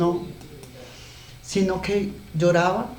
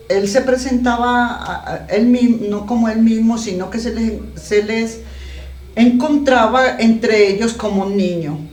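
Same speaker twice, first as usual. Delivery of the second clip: fervent preaching; 4 semitones higher, 6 dB louder than usual. Acted. Preaching on the book of Judas.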